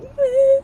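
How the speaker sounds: high whimpering sob of a mom left behind; mock sob. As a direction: imitate a brief teary whimper. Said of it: "the mom's like".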